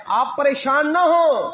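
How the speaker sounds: slow, high preaching delivery; high, measured promise to a beloved; proclaimed reassurance. Retold or imitated. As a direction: proclaim it slowly, drawing out each phrase.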